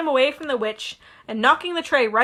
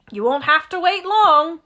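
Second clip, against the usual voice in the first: louder, lightly voiced, amused; grinning Hagrid; 5 semitones higher, 5 dB louder than usual. Hagrid teasing Harry, amused. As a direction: say it with a grin. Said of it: "grinned Hagrid".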